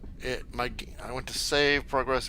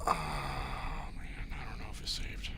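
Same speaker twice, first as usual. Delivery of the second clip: worried whisper; 7 semitones lower, 4 dB quieter, slower than usual. Real, not acted. Worried game may not save.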